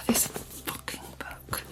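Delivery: exasperated whisper, no voice; frustrated whisper. Real, not acted. Reader whispering frustration at the book. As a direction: hiss it under your breath.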